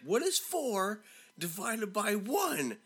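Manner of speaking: high, slow, exasperated demand; retold teacher's yell, every word drawn out; mock yelling. Teacher fed up with Sarah, high slow mock yell. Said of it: "so now he's, like, yelling".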